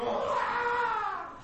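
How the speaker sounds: high startled yelp of panic; overwhelmed cry. Retold or imitated. Juggler swamped by an extra task, high yelp. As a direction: yelp as one thing too many lands. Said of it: "And you go".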